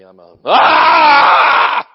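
high, loud, stretched holy cry; drawn-out ecstatic yell; preacher's scream. Real, not acted. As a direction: scream like a fired-up evangelist. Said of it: "He's turned into Rod Parsley".

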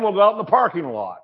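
normal talking voice

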